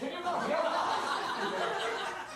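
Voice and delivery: hammed-up outrage at a bad rhyme; drawn-out mock indignation; mock exasperated. Host mock scolding contestant.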